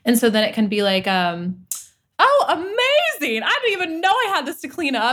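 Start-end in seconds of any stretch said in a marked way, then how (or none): 2.2-5.1 acted delight. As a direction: gush as if thrilled by a discovery. Acting out delight at a trigger.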